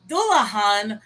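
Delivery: Dullahan as DOO-la-han, falling; variant pronunciation. Shown as a demonstration. Listing possible names of the creature, Dullahan sounded out carefully. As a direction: say Dullahan with an H in the last syllable. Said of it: "So it's either the".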